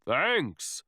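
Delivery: mild, lightly voiced big monster; a gentle giant's mild boom. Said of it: "booms the monster".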